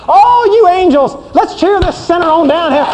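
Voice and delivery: high, eager cheer to come on down; rally the angels to cheer him home; excited cheering. Acted. God cheering sinner home.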